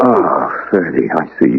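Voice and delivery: barely holding back tears; choked up. Acted. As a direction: let the shock break into a near sob. Shocked news of caller's age.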